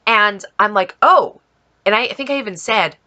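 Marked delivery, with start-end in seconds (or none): 1.0-1.4 surprised oh. Retold or imitated. Car pulls up, she reacts.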